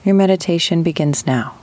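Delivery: unhurried meditation guide, long pauses between phrases; guided meditation. Acted. Host guiding listeners with chronic pain into rest, guided meditation. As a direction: ease them gently into breathing, drawing out key words.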